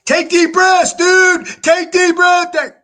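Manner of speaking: hammered-up panic, pushing him to breathe; frantic urging yell. Retold or imitated. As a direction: friend yelling while he sprays. Friend urging while spraying.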